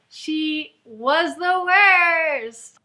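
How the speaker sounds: "was the worst" stretched very slowly and loudly; exasperated, drawn-out verdict; drawn-out emphasis. Real, not acted. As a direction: drag out WORST with loud disdain. Emphatic exasperated rant on character.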